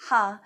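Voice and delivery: laughter exhale. Shown as a demonstration. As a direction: breathe out a bold ha. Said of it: "We'll do it again".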